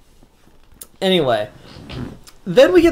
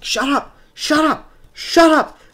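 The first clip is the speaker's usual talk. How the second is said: mock high-pitched shout, building louder; frantic outburst; 12 semitones higher, 4 dB louder than usual. Billy Batson yelling back at the voice in his head, frantic. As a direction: act out someone cracking, higher and louder each time.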